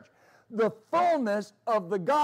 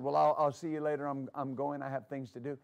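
soft-spoken Lawrence; 6 semitones lower, 5 dB quieter than usual. Retold or imitated. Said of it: "Lawrence is very soft-spoken".

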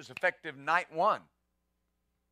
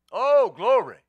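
loud cry of glory; shout of praise; 4 semitones higher, 12 dB louder, slower than usual. Real, not acted. Pastor shouting praise over Jesus' certain word, loud. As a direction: let the praise burst out.

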